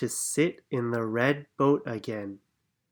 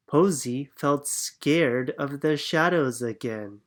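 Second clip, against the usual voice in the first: plain reading voice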